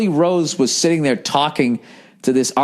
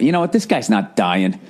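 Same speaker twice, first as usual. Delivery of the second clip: ordinary talk, nothing marked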